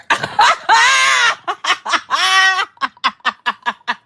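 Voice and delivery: loud, high burst of laughter; big shrieking laugh. Real, not acted. Telling her listeners, a loud shrieking laugh. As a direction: crack up hard.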